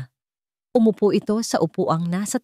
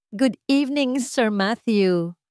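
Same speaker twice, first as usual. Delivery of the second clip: lightly voiced associate, higher and slowed; a polite associate's drawn-out greeting on a video call; slow, higher deferential greeting; business associate greeting; 5 semitones higher, slower than usual.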